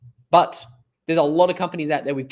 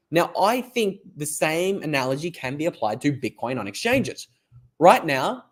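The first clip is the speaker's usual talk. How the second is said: ordinary talk, nothing marked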